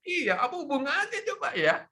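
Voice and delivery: high playful rhetorical question; playful quiz tone; teasing. Real, not acted. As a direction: tease them before the answer.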